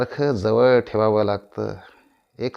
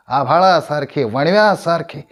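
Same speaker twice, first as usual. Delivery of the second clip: higher, louder poetic flourish; swelling declamation; 4 semitones higher, 6 dB louder than usual. Acted. Actor likening his words to sky and wildfire, soaring. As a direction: let each image soar.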